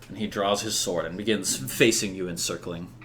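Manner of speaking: usual speaking voice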